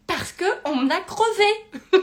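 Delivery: high, loud, drawn-out outburst; exaggerated panicked wail; mock panic. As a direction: cry it out in comic alarm. Panicked answer to calm partner.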